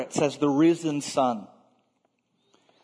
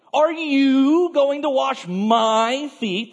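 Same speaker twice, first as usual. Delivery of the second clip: loud, high, drawn-out stress on "you" and "my"; emphatic question; 9 semitones higher, 5 dB louder, slower than usual. Retold or imitated. Peter objects to Jesus washing his feet, emphatic. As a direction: stretch and punch "you" and "my" in an incredulous-sounding question. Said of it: "his question is quite emphatic".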